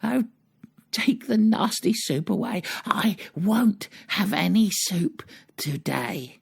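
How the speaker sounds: hammed-up tantrum, each phrase held out; screaming refusal. A narrator's reading. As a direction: wail it high as a stubborn storybook boy. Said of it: "he screams as loud as he is able".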